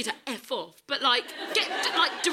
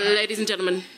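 mock announcer; 2 semitones higher, 4 dB louder than usual. Runner addressing Facebook about her outrage, mock announcer.

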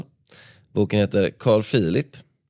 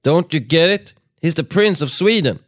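high, loud exasperated shout; 7 semitones higher, 4 dB louder than usual. Acted. Someone yelling at the crowd who he is, loud high shout. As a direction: yell it at the bystanders. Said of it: "hörde någon ropa".

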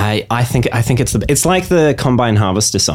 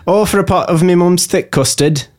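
northern accent; 6 semitones higher than usual. Shown as a demonstration. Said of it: "Get him to do the custard one".

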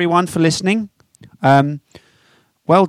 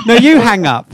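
laughing, loud lovey-dovey tease; giggly late night call; 5 semitones higher, 7 dB louder than usual. Pretend. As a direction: giggle it like a smitten couple. Mock lovers' hang-up banter.

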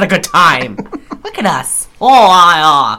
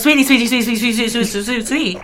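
higher, repeated sweetie fading off; Jennifer Aniston impression; 5 semitones higher, much faster than usual. Doing Jennifer Aniston for the friend, higher cooing impression. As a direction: coo sweetie in a put-on voice, trailing away. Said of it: "Jennifer Aniston goes".

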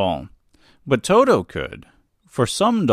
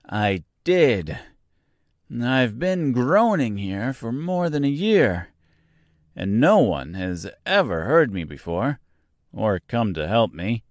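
plain reading voice

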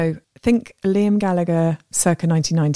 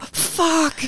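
dismayed curse; 11 semitones higher than usual. Retold or imitated. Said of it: "And I was like".

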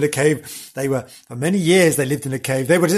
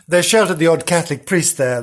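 ordinary talk, nothing marked

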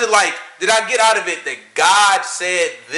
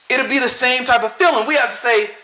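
ordinary talk, nothing marked

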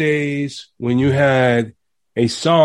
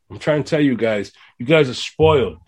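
ordinary delivery

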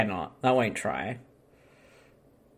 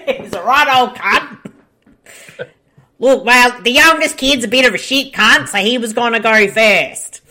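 raised-pitch ocker Australian; exaggerated Aussie accent; 4 semitones higher than usual. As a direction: explain it in a hammed-up Aussie twang. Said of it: "doing our Aussie accent".

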